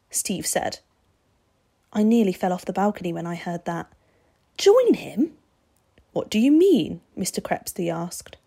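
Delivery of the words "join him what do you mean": high, loud, incredulous questions; stunned. A narrator's reading. Vampire hearing the boy wants to join him, stunned. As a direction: question it in raised disbelief. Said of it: "as stunned as I was".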